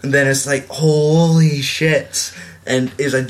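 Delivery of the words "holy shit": long drawn-out, slow awe; mock astonishment. Friend reacting to a thrown chair, drawn-out mock shock. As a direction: stretch it out in stunned disbelief.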